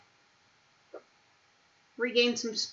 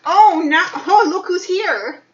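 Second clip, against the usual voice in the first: high, loud, slow delighted cooing; 8 semitones higher, 12 dB louder, slower than usual. Real, not acted. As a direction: greet a pet with squealing delight. Greeting a pet excitedly.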